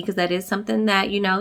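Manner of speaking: ordinary delivery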